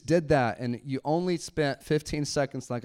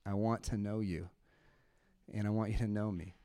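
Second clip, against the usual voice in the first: quiet prayer; 6 semitones lower, 9 dB quieter than usual. Shown as a demonstration. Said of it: "you just close your eyes and you say".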